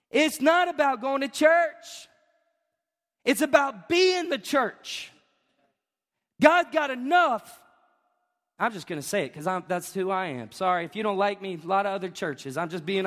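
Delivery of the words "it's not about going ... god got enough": high, slow punchy declarations; slow, emphatic pulpit proclamation; full-force preaching. Real, not acted. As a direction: preach it out with big emphatic pauses.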